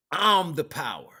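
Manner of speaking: ordinary delivery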